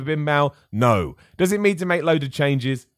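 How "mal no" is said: ordinary delivery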